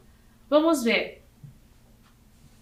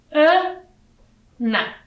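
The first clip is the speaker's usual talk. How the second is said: "Ana" in two beats, higher A... then na; syllable by syllable; 4 semitones higher, 3 dB louder than usual. Shown as a demonstration. Teacher showing children two syllables, split apart. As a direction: count out "Ana" with a clear pause. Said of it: "Ana tem duas sílabas".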